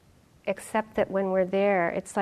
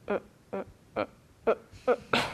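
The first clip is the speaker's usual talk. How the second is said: straining hum; 5 dB quieter, faster than usual. Retold or imitated. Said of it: "the whole way it was like".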